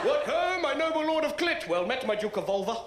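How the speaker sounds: lightly hammed-up Shakespeare voice; a hint of theatrical old nobleman; Shakespearean character. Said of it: "a Shakespeare character called Clitoris".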